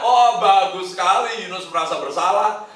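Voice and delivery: ordinary talk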